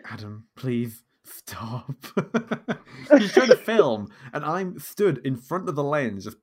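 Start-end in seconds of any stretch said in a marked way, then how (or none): 0.1-1.9 slow, drawn-out worn-down plea; pleading mum. Retold or imitated. Mum filming begs her poking son to stop, pleading. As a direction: imitate a mother at breaking point. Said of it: "her just being like".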